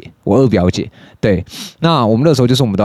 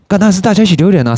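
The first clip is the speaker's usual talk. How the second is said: emphatic answer; 10 semitones higher, faster than usual. Shown as a demonstration. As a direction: fire back 当然是大家一起丢脸 with glee. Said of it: "你要一个人丢脸还是大家一起丢脸？".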